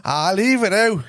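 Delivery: put-on Dublin accent. Shown as a demonstration. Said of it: "Do you wanna hear his dull accent?".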